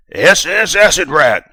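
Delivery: booming high announcement; loud speaker voice. Acted. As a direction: blare it over the speakers. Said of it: "The voice over the speakers was loud".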